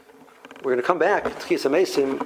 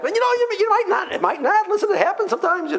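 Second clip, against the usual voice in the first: very high, doubtful voice; acted-out reluctant hedging in high register. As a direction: squeak out the doubts, pitched way up. Voicing someone hedging about lost item.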